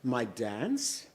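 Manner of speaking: high, sugary coaxing voice; butter up the gum baby for yams; sweet-talking Moesha. Acted. Moesha coaxing the gum baby, sweetly.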